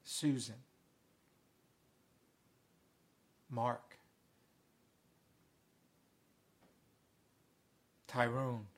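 low, hushed call of each name, long silences between; calling names softly. Acted. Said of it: "Jesus is saying your name".

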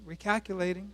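soft, quiet recalculating with a slight rise; gentle GPS voice. Retold or imitated. The Holy Spirit gently corrects us, spoken softly. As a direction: say it gently, like a kind nudge. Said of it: "gently at times says".

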